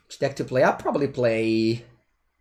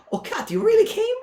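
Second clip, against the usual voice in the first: high, louder squeal of surprise; delighted surprise; 5 dB louder than usual. Real, not acted. Streamer greets the cat that really came, surprised. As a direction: exclaim in high-pitched disbelief.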